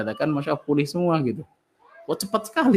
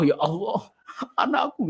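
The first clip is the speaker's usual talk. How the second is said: imitating a protesting sob, much higher on anakku; mock wailing complaint; 8 semitones higher, slower than usual. Preacher imitates a mother crying to God, mock wailing. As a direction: wail it to God as if refusing his decree. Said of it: "kalau misalkan nangisan di depan ke Allah".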